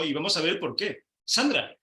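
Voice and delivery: normal talking voice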